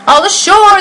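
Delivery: high, loud call of ashore; shouting sailors. Acted. Sailors calling everyone off the ship, high and loud. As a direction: call it out across the dock. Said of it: "the men shouted".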